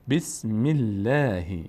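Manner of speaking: "بسم الله" slowly, thin L and fronted long "ae"; light L. Shown as a demonstration. («بسم الله» کشیده با لام باریک)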